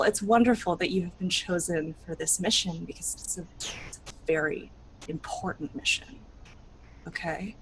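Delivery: hushed, secretive urging; whispered confidence to a chosen child. Acted. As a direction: lean in and whisper it. Confiding urgent importance.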